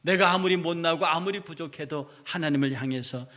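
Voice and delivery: ordinary delivery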